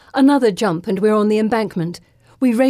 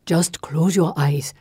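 slow, low whispery reassurance; whispered; 4 semitones lower than usual. A narrator's reading. Companion guiding a frightened girl onward, whispering. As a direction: coax slowly under the breath. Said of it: "he whispers".

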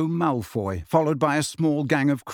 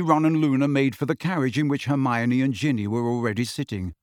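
ordinary delivery, nothing marked

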